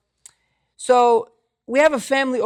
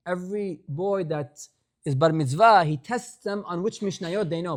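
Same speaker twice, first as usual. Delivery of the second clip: normal talking voice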